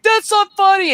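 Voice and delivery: high, loud hurt protest imitated; mock upset cry. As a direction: shout it like she was fooled. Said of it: "she's like".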